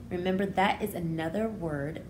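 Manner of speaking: ordinary talk, nothing marked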